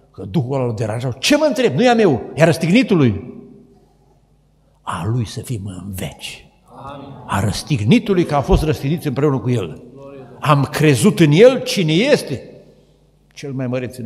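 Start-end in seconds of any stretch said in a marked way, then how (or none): 1.1-3.4 high, loud, annoyed retort; irritated spirit. Retold or imitated. Annoyed spirit answers sorcerer. 10.4-12.5 proclaimed. Acted. Preacher climaxes declaration.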